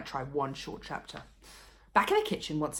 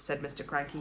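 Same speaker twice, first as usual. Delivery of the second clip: ordinary delivery, nothing marked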